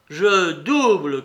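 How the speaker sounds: slow, loud announcement; crying out. A narrator's reading. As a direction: call the bet out boldly. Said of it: "cria Mitya".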